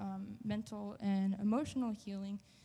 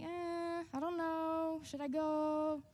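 higher, wavering self-doubt; unsure whether to go pray for a stranger, higher and hesitant; 7 semitones higher, slower than usual. Retold or imitated. Unsure about approaching the lady.

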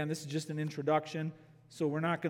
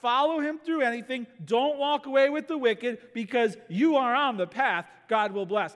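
emphatic preaching; 6 semitones higher, 4 dB louder than usual. Real, not acted. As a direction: lay down the gauntlet to the congregation. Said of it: "This is emphatic".

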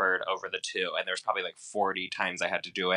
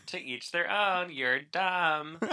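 normal talking voice

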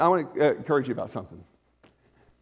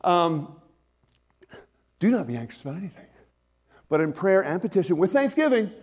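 raised, fervent preaching voice, slowed into the key line; preaching at full force; 6 semitones higher, 6 dB louder than usual. Real, not acted. Pastor to his congregation on Philippians, fervent preaching. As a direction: drive the scripture home to the room.

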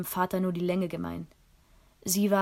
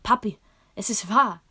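loud excited outburst, pitched up; blurting out; 4 semitones higher, 10 dB louder than usual. A narrator's reading. Girl bursts out the good news to her father, loud and excited. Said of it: "bis ich schließlich doch herausplatzte".

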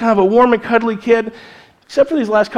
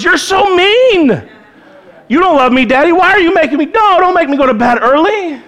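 put-on whiny child; 8 semitones higher, 3 dB louder than usual. Son Lucas complaining to daddy about early bedtime, high whiny child voice. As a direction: plead like a sulky little boy. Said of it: "Then comes the harsh words".

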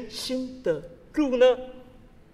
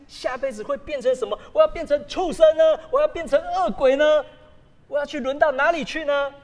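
mock worry; 6 semitones higher, 6 dB louder than usual. Voicing fearful questions about rebirth.

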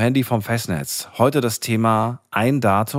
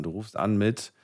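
usual speaking voice

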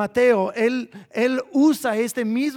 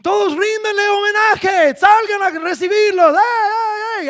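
herald's shouted announcement; 9 semitones higher, 6 dB louder than usual. Acted. Heralds going ahead of the prince, shouting for homage. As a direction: proclaim it over a whole crowd, stretching the words. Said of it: "gente que iba por delante de ellos diciendo".

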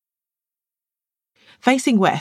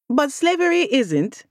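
higher, slow sly jab; a smirk you can hear; smirking retort; 11 semitones higher than usual. Acted. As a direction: lay the sarcasm on slowly. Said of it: "Amaka smirked".

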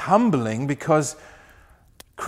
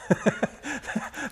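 high laugh; 10 semitones higher, 6 dB quieter than usual. Real, not acted. Laughs at mouthwash gift.

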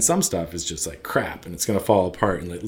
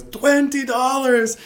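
very high, drawn-out outraged squawk; incredulous mock shout; 4 dB louder, much slower than usual. Tailor reacting to a customer's lowball price, incredulous shout. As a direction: repeat the price in exaggerated disbelief. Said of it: "he shouts in the back".

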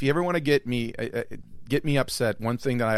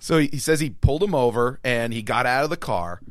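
usual speaking voice

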